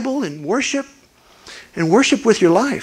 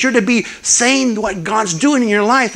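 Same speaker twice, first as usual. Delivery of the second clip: usual speaking voice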